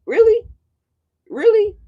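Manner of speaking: high, loud disbelieving repeat; incredulous really. Retold or imitated. Mocking the woman swearing in at court, high and loud. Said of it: "And I'm like".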